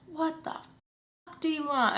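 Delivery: high, slow mock demand without volume; mom's angry confrontation. Mom confronting her after grabbing the phone, mock angry. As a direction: imitate mom demanding it right in your face. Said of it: "Okay, in my face".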